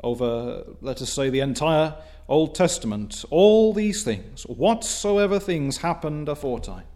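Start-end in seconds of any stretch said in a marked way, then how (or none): 3.3-6.9 scripture quote. Acted. Preacher quoting scripture to the congregation, solemn and raised. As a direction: intone the verse with weight. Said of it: "a verse which I quote very often".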